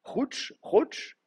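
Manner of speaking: Chouch, Chouch spelled out in a high, breathy demo; root Chouch said slowly for the class; base form demo. Shown as a demonstration. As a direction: sound out Chouch as the bare root. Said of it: "vous repartez sur la base Koutl".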